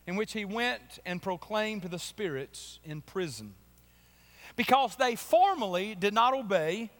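solemn scripture reading. Acted. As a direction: read the verse with weight. Reads scripture aloud.

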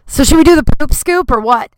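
loud kickoff. Real, not acted. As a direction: announce the poop scoop loudly.

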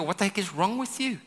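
high, scornful what-the-heck; mock scolding. Wrong leader to the people, high mock scold. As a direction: sneer it like a scold. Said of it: "He doesn't go".

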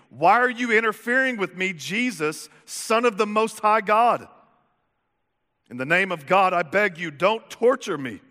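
dramatized scream from a possessed man; screamed plea. A narrator's reading. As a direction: cry it out to the back of the room, short of a shriek. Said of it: "with a shriek, he screamed".

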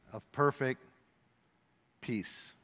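trailing off to a hushed peace; fading to a hush. Acted. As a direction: let the last word dissolve into calm.

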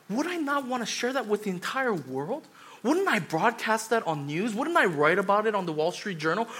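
usual speaking voice

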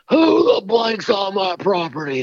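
high, drawn-out mock holler; imitating irate farmer cussing. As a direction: play an outraged farmer hollering at trespassers. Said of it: "This farmer's cussing Blake up and down".

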